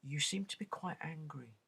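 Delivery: soft unvoiced condescending whisper; whispered mock psychologist. Psychologist whispering to a heated colleague, mock calm. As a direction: hush it like a smug therapist. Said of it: "They'll say".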